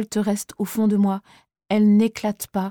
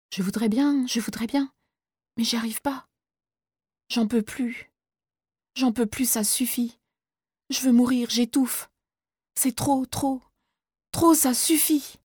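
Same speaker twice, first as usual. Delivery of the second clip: panicked despair; 3 semitones higher than usual. Acted. Boy panicking, overwhelmed.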